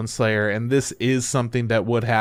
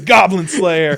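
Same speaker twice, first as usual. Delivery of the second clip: loud, higher mock-dramatic title call; title announcement; 4 semitones higher, 5 dB louder, slower than usual. Title appears as the movie ends, mock-epic. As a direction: belt it out as the big title drop.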